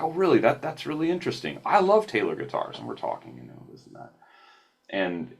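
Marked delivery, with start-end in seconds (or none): 0.0-2.8 impressed stranger. Retold or imitated. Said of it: "He's like".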